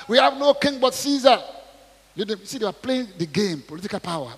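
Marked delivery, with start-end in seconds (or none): 0.1-1.4 defiant shout. Acted. Mob shouting back.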